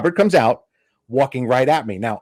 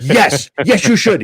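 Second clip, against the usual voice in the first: eager agreement; 6 semitones higher, 5 dB louder, slower than usual. Retold or imitated. Mentor eagerly agrees to intro.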